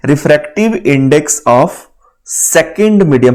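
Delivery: very slow, each word spaced out; slow reading of formula. Shown as a demonstration. Teacher showing students how the formula is read aloud. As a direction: read it out slowly, showing the right way. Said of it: "इसको पढ़ने का एक तरीका होता है".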